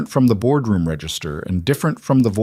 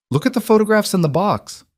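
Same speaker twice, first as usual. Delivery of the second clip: much higher, insistent; insistent repeat; 12 semitones higher than usual. A narrator's reading. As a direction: press the request urgently. Margot repeats the text urgently.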